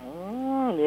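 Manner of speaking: ordinary delivery, nothing marked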